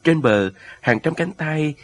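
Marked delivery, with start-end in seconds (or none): none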